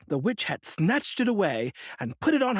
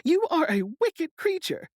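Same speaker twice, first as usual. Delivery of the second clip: put-on higher girl's voice, indignant; little girl crying out; 13 semitones higher than usual. A narrator's reading. Dorothy scolding the witch who stole her shoe, indignant. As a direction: protest up high like a wronged child. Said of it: "cried Dorothy".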